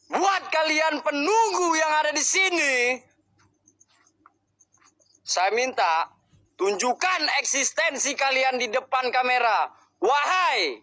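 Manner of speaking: high, solemn call to the spirits; summoning call. Acted. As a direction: call out to the unseen guardians of the place. Calling out to spirits on camera.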